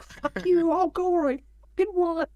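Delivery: high-pitched mock yelling; retold angry yell. Intruder defying the director on set, mock angry yell. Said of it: "yelling at me".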